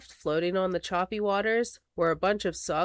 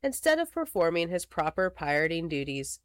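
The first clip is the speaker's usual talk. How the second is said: plain reading voice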